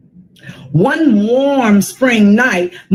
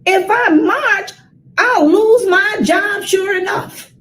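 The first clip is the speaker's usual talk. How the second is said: mommy's worry; 9 semitones higher than usual. Acted. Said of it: "When I heard my mommy say".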